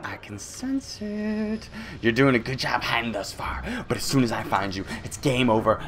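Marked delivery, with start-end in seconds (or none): 0.0-1.7 slow, high and soft, drawn out; creepy slow menace. Acted. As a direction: stretch it out like a lurking monster. Creepy monster taunt.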